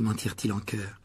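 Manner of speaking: ordinary delivery, nothing marked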